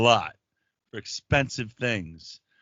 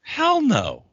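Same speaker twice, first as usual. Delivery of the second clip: much higher, louder hell no; mock outraged refusal; emphatic refusal; 7 dB louder than usual. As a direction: shoot it down flat out. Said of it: "And I was like".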